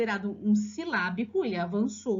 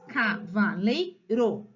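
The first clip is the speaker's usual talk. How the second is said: slow, CAVALEIRO split into ca-va-lei-ro; syllable by syllable; 3 semitones lower, much slower than usual. Shown as a demonstration. Teacher showing parents the four syllables, syllable by syllable. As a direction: break CAVALEIRO into four separate beats. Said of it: "quatro sílabas".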